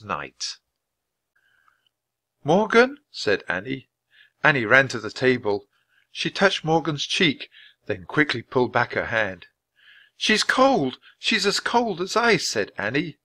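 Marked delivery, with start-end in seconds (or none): 2.4-3.0 put-on high, worried girl calling; high-pitched questioning cry; anxious call. A narrator's reading. Annie calls to frozen Morgan. 10.2-12.5 put-on high, alarmed girl's voice; high-pitched dismay; distressed. A narrator's reading. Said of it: "Tears filled her eyes".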